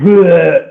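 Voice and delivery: loud, high mock retching; puking noise. Drunk boyfriend throwing up in the sink, imitated retch.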